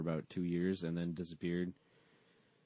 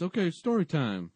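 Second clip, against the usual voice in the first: high, louder mock call to class; teacher's announcement; 9 semitones higher, 6 dB louder than usual. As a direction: call the kids to attention. Acts teacher announcing story time.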